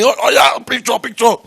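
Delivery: high, loud fawning gush; mock eager founder. Speaker mocks founders begging investors for photos, high gushing.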